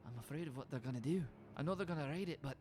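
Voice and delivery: lightly voiced, uneasy young woman; a touch of [name] fear. [name] confides fears to Linnea.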